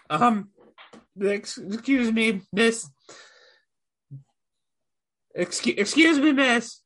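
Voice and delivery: high, hesitant goblin voice; high goblin voice addressing the barmaid; timid goblin. Acted. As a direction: creep up and ask nervously, pitched high.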